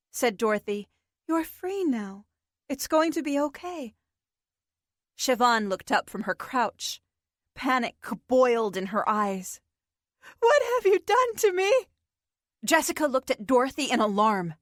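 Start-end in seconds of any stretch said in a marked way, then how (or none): 1.3-3.9 gentle reassurance. Acted. Dorothy reassures freed girl. 10.4-11.7 high, loud panicked demand; panicked accusation. Acted. Panic boiled in her eyes.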